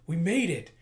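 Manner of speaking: excited cheer. Acted. Crew bursting out of hyperdrive, excited cheer. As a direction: call out in triumph.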